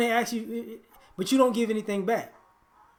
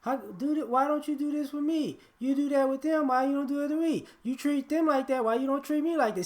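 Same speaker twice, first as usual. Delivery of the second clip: put-on higher-pitched child's plea; mock childish complaint in a raised voice; complaining child; 5 semitones higher than usual. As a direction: imitate a child pleading to be treated alike. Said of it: "A child will express themselves".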